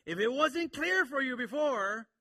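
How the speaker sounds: loud, high and slow; forceful emphasis. Retold or imitated. Preacher speaks as John to his readers, loud and emphatic. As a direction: drive it home to the back row. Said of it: "That's his emphasis".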